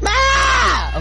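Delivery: startled shriek. Real, not acted. Told to keep reading, she yelps in a sudden shriek. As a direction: let out a sharp startled cry.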